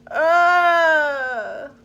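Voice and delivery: loud pained cry; loud groan. Acted. Crocodile with a toothache, loud pained groan. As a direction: groan out in terrible pain. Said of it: "a loud groaning sound".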